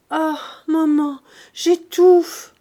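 high, loud cry of anguish; distressed cry. A narrator's reading. Daughter alone with her mother, distressed cry. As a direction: cry out as if suffocating. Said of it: "s'écria Eugénie".

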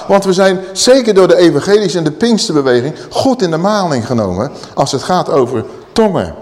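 normal talking voice

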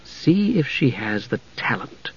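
slow, very soft prompt; hushed suggestion. A narrator's reading. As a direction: suggest it quietly and slowly. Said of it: "Jenna suggested, guessing his thought".

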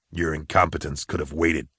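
low, quiet surly bounty hunter; gruff jibe. Acted. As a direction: grumble contempt in a deep voice. Gruff guard jibes at visitor.

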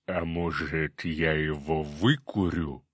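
low, slow cyclops rumble. A narrator's reading. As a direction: play a big, slow-witted cyclops. Cyclops voiced in story.

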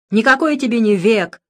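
loud indignant retort. A narrator's reading. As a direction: snap back, outraged. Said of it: "возмутился отец Александр". (возмущённо, громко)

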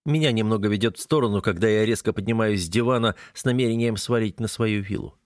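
plain reading voice

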